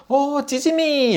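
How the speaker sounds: high, slow and delighted; delighted Japanese neighbor. Retold or imitated. Japanese neighbor opening the door, slow high delight. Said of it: "일본인 아줌마가 문을 딱 열고 하면서".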